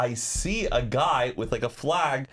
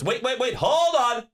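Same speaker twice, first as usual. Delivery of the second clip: higher, hurried hold-on; mock urgent stop; 10 semitones higher, 4 dB louder than usual. Imitating the cart guy stopping the fan. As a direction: halt everything mid-task. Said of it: "He goes".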